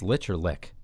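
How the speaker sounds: "Lich" ending in ch, "Lick" in k; two pronunciations contrasted. Shown as a demonstration. Host unsure of the game title, "Lich" with ch against "Lick" with k. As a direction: contrast the soft end of "Lich" with the hard k of "Lick". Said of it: "how to pronounce that".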